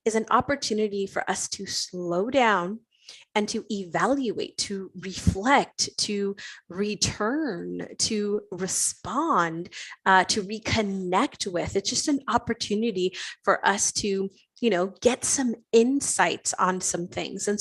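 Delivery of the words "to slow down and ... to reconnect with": slow and deliberate. Real, not acted. Slowing down to reflect.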